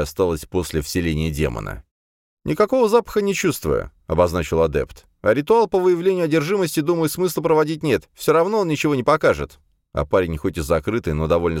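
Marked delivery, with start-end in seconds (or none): 2.4-3.9 put-on high young man's voice; higher, brisk young adept. 5.2-9.6 put-on high young man's voice; higher, brisk young adept. Adept reasons aloud.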